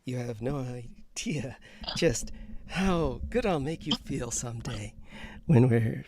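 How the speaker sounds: breathy sweet-talk whisper, then a mild fake croon. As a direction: whisper like a syrupy lover. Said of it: "They don't like your fake voice".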